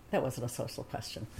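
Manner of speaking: low, quiet retort; dropped-down, muted reply; retold dry retort. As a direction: say it low and under your voice, dropping away. Aside under her breath.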